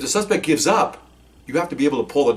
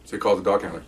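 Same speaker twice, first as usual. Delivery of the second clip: ordinary talk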